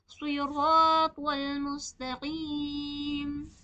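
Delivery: सिरातल मुस्तकीम slow with full-mouthed स्वाद, रा, त्वा; heavy letters. Shown as a demonstration. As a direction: stretch it, fill the mouth on each heavy letter. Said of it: "तीनों को पुर पढ़ेंगे".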